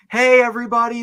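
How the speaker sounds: loud, high mock announcement to a crowd; calling out. Co-host imagines friend alerting everyone at the bins, calling out. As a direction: mockingly holler for everyone's attention.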